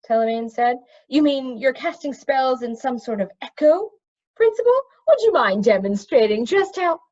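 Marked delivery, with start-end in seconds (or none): 1.1-7.0 high, careful scholarly magician voice; precise magician. A narrator's reading. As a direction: lecture it like a fussy expert. Telemain curious question.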